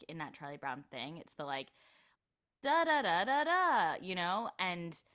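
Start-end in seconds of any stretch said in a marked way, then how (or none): 2.6-3.9 "Da, da, da, da, da" sung out high and loud in an even rhythm; iconic speech pattern. Shown as a demonstration. Showing the Charlie Brown line delivery, iconic speech pattern on "Da, da, da, da, da". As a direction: chant "Da, da, da, da, da" as a raised, loud line melody. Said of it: "specific speech pattern that, like, is really iconic".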